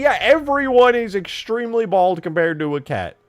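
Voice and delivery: loud, high burst easing down; indignant. Real, not acted. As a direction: concede loudly under teasing. Conceding to teasing, indignantly.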